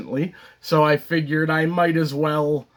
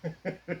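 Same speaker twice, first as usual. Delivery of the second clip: very quiet acted laugh; a small private laugh to oneself; soft chuckle; 2 semitones higher, 14 dB quieter than usual. Moon laughs within the monologue.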